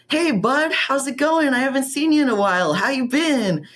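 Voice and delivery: high, sugary mock social worker greeting; overly friendly fake-cheerful caseworker.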